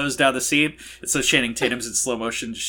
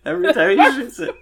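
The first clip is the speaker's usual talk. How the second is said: usual speaking voice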